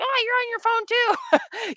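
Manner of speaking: mocking comeback. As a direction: throw it back at them in a teasing whine. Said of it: "and they're like".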